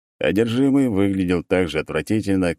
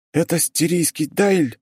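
bleating; 11 semitones higher, slower than usual. Acted. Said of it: "проблеял практикант".

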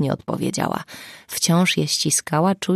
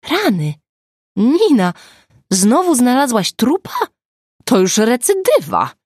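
higher, louder excited exclamation; excited teasing; 7 semitones higher, 6 dB louder than usual. Acted. Agata teasing Nina about another corpse, loud and excited. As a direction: tease her with relieved glee. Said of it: "rzuciła Agata".

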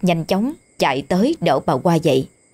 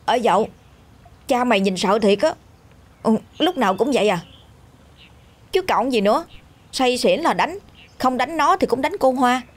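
put-on lightly raised child voice of a village boy; a chatty young boy, a bit higher; 6 semitones higher than usual. Boy Tí gossiping to friends.

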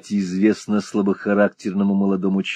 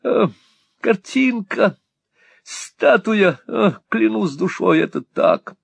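much higher, breathless raving; smitten young lord; 11 semitones higher, slower than usual. A narrator's reading. Lovesick lord praising the girl to Hawk. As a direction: rave about her like a lovesick boy.

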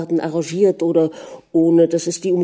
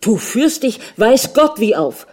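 raised, high angry outburst; angry shouting; 5 semitones higher, 4 dB louder than usual. A narrator's reading. Husband berating wife in the nursery, shouting angrily. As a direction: cry out in indignation. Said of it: "schrie er".

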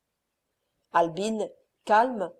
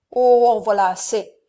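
loud burst of anger; the count erupting at his calm wife, loud; explosive outcry; 7 dB louder than usual. A narrator's reading. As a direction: explode with fury. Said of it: "s'écria le comte avec explosion".

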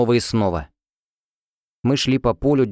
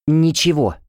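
higher, louder clipped huff; huffy snort; 6 semitones higher, 4 dB louder, slower than usual. Acted. Roommate brushing her off with a huff. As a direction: huff it out dismissively. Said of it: "фыркнула она".

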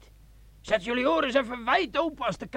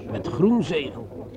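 much lower, sly and intimate; low seductive sergeant; 10 semitones lower than usual. Acted. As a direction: coax her into drinking, low and sly. Sergeant speaks low to Margot. (sergeant fluistert laag en verleidelijk tegen Margot)

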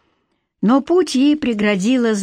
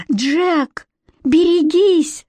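screaming warning; 8 semitones higher, much slower than usual. Acted. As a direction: yell the warning high and drawn out. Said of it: "закричала Златовласка". (громкий испуганный крик)

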